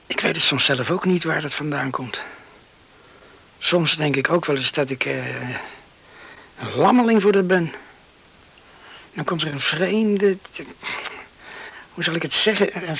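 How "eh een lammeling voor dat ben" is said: ordinary delivery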